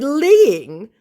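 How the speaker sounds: high, loud disbelieving echo; incredulous. Retold or imitated. Mocking the scammer's claim with high incredulous echo. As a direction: throw the word back in disbelief.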